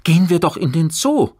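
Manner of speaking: high, loud, excited suggestion; eager exclamation, pitch well up; calling out. A narrator's reading. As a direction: burst out with the bright idea. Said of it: "rief er".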